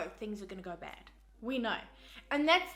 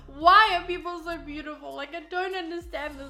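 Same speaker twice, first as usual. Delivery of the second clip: high, loud mock wail that eases off; hammed-up tearful cry over nothing; mock bawling; 4 semitones higher, 7 dB louder than usual. Recalling bawling over beautiful people, hammed-up crying. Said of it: "I started to bawl my eyes out".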